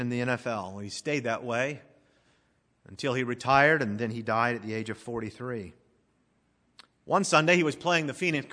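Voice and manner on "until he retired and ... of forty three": ordinary talk, nothing marked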